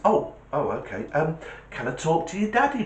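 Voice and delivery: high, sing-song caller voice; talking to a child. Acted. Caller asking a child for a parent, high-pitched.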